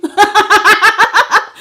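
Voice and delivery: high, loud burst of laughter. Real, not acted. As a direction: crack up laughing. Laughs at own singing.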